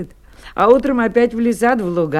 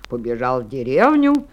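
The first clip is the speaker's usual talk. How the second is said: usual speaking voice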